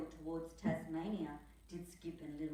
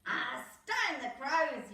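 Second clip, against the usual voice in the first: louder, slow excited yell; Nell yelling; 7 semitones higher, 8 dB louder, slower than usual. Acted. Nell yelling in delight at the race, slow loud yell. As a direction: shout it with glee. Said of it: "yelled little Nell".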